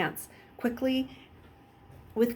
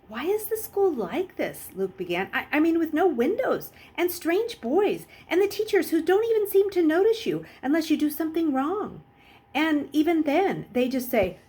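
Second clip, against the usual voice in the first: higher, louder rush of questions; anxious boy; 9 semitones higher, 4 dB louder than usual. Acted. Luke questioning Jackal Boy, higher and urgent. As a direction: pour out the worries.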